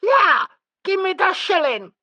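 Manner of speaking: put-on high, loud demanding child; yelling child. A narrator's reading. Sissy grabbing for the coin, yelling. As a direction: yell it like a greedy girl.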